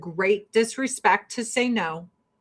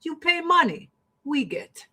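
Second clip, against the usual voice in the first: higher mock Russian accent, laughing at the end; Russian officials admitting the Olympic bribe, in a higher mock Russian accent; 3 semitones higher than usual. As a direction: play the Russian official cheerfully admitting corruption. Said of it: "They're like".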